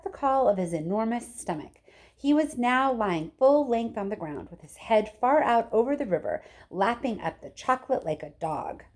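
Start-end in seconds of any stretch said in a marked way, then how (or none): none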